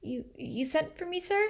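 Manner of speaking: put-on nervous girl squeaking out the question; timid squeaky voice. A narrator's reading. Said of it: "she squeaked".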